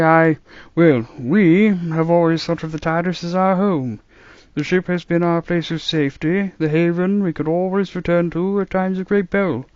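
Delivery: put-on musing old man, baffled; puzzled grandfather voice; old doctor voice. A narrator's reading.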